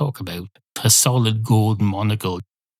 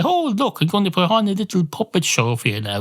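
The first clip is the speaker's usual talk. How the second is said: high, sing-song mock entertainer voice; hammed-up children's entertainer; mock showman voice; 8 semitones higher than usual. Parent voicing ventriloquist to kids.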